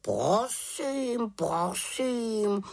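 offended raven, high and drawn out; uraženě. A narrator's reading. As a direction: huffily give in as an affronted raven. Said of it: "urazil se Abraxas". (uražený havraní hlas)

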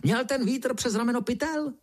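high, squawking cross-question; indignant, high-pitched grilling; angry interruption. A narrator's reading. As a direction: demand it crossly in a high beetle voice. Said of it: "zlostně černý brouk".

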